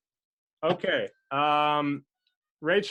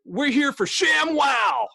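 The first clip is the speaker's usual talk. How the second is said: high, slow infomercial shout; stretched, over-the-top salesman holler; TV pitchman; 10 semitones higher, slower than usual. Shown as a demonstration. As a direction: sell it hard like a TV ad. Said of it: "like you're on, uh, T V".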